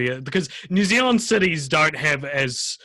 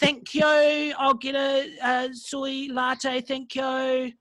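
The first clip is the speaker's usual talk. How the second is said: much higher, slow drawn-out posh café order; exaggerated Ponsonby mum; 12 semitones higher, slower than usual. Mocking a Ponsonby mum ordering coffee, high and slow. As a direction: order a soy latte like a rich Auckland mum. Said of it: "Ponsonby mums talk like this".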